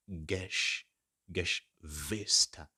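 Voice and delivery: Geschwister spelled out slowly, syllable by syllable; careful pronunciation. Shown as a demonstration. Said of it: "Remember, it's".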